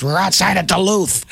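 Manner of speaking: high, gruff answer; grumpy grandpa, lightly voiced; mock angry grandpa. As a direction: bark the wrong answer. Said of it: "All angry".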